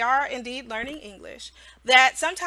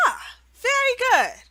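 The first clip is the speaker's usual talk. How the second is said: much higher "Ah, very good", then slow question; cheery teacher redirect; 6 semitones higher than usual. Shown as a demonstration. Teacher redirecting the child back to the question, bright. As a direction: praise then gently re-ask. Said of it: "You say".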